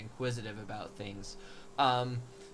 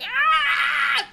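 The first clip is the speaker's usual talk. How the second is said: shrill, loud high-pitched shriek; high scream; 14 dB louder than usual. Pretend. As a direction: let out a terrified scream. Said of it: "Are you like".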